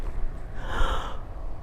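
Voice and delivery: sharp, breathless gasp of horror; shocked gasp. Shown as a demonstration. Demonstrating body language, a horrified gasp. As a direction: gasp as if you just saw something awful. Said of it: "This is the body language".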